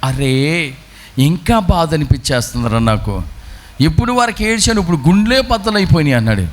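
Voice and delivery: ordinary talk, nothing marked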